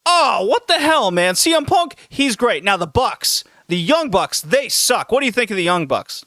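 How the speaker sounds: high, excited rant from a pushy fan; ranting wrestling fan. Retold or imitated. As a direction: rant like a fan who won't let go. [name] rants about wrestlers.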